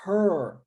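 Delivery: "her" com vogal fechada e curta; her fechado. Shown as a demonstration. ("her" pronunciado fechado)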